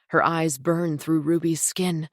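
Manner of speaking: usual speaking voice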